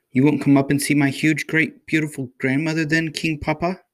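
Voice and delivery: high, sweet pleading little princess; cartoon princess. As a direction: coax Papa like a storybook princess. Princess pleads to her father.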